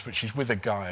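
ordinary talk, nothing marked

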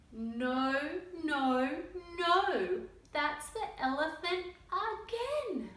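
the monkey yelling in frustration; loud exasperated protest. Acted. Little monkey yelling at Butterfly over the elephant, loud exasperation.

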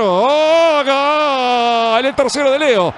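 high ecstatic goal call; goal scream. Real, not acted. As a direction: belt out the goal, high and long.

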